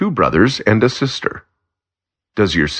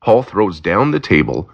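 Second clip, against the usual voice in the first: usual speaking voice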